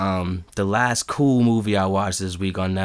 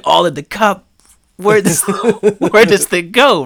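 put-on baffled kids; 8 semitones higher, 6 dB louder than usual. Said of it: "The kid was so".

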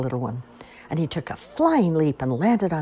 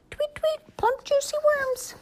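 very high chirpy bird; slower than usual. Acted. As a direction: squeak it like a tiny bird.

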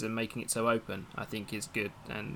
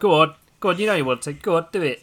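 loud, playful coaxing; urging; 4 semitones higher, 10 dB louder than usual. Real, not acted. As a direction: egg the viewers on. Host urging viewers to donate.

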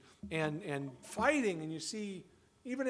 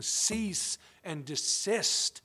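very slow, each word weighted; commanding God; 4 dB louder, much slower than usual. Acted. Preacher voicing God's command to rebellious nations, very slow. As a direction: lay down a divine order. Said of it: "he's saying".

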